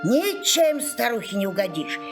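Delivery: plain reading voice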